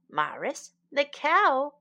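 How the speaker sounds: ordinary delivery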